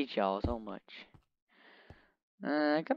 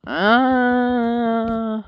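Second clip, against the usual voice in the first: silly high uh; 11 semitones higher, 9 dB louder than usual. Acted. Odd high held hesitation.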